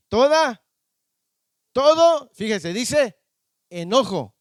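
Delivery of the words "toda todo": loud, high rising exclamation; rising shout. Real, not acted. Preacher shouting out to the congregation, loud and high. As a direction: call it out to the congregation.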